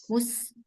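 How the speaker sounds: "Mus" with breath flowing through the final s; aliran napas. Shown as a demonstration. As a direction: let the s of "Mus" flow on. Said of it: "ada aliran nafas juga nggak?". ("Mus" dengan napas mengalir di huruf s)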